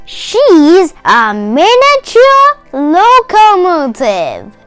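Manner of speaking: usual speaking voice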